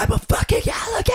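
high, fast, defiant yell; drunken yell. Retold or imitated. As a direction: scream it back at the woman. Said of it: "And I was like".

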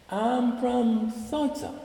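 high, slow, hesitant answer; meek student reply. Retold or imitated. Student answering the repeated question, slow and timid.

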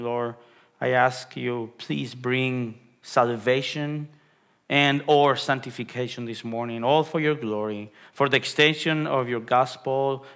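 soft, low prayer voice; hushed earnest prayer. Acted. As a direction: pray quietly and humbly to the Lord. Closing prayer before the sermon.

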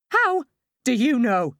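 high, loud, slow screech; mother in labour screeching at her husband, loud. A narrator's reading. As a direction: screech it at him in pain. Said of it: "I screech at him".

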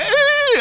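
high-pitched comic wail of an overwhelmed fan; mock ugly cry. Starstruck guest seeing her idol appear, mock ugly cry. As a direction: squeal it like a starstruck fan breaking down. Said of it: "she got the ugly cry".

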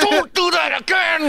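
high, drawn-out angry shout; angry outburst. Retold or imitated. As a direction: yell it slowly in a strained, high voice. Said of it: "I was, like, still angry".

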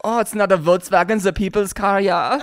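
put-on German-accented correction with a tag "ja"; playful German know-it-all; mock German accent. Mock German corrects his friend.

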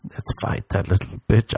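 breathy whisper of mock menace; whispered aside. Host whispering to listeners about a fight. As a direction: hiss it conspiratorially.

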